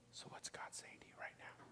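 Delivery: hushed, breathy question to the church; whisper. Real, not acted. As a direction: draw them in with a whisper. Pastor hushes the congregation.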